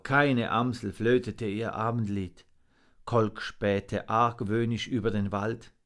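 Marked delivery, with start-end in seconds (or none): none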